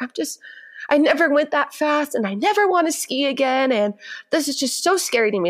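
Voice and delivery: a hint of tearful little skier; lightly voiced upset child. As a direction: plead like a shaken child, understated. Retelling the tearful child's complaint.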